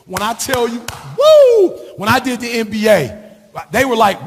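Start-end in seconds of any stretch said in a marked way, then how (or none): 1.2-1.7 high, loud whoop of joy; excited whoop. Retold or imitated. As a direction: let out a big excited woo. Hyped recalling his speed test.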